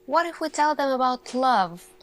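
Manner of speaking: ordinary delivery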